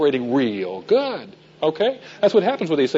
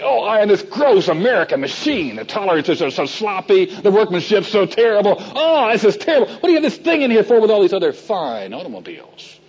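higher, mock-scornful complaint; disgusted guest; 5 semitones higher than usual. Guests scorning the Thunderbird in Ian's garage, mock disgust. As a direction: sneer at the cheap American car. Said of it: "they would go on and say".

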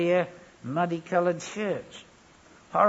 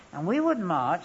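ordinary talk

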